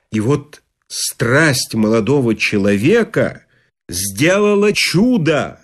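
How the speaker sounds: ordinary delivery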